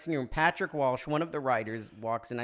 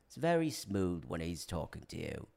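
low, soft, smooth British actor voice; smooth Michael Caine; 9 semitones lower, 8 dB quieter than usual. Shown as a demonstration. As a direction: murmur it smoothly, like an impressionist. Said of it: "is, like, very smooth".

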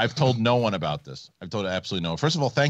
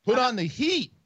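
"Put on the heat" pitched much higher and pleading; higher insistent demand; 12 semitones higher than usual. Real, not acted. Host nags the guest to turn on the heat, high whine.

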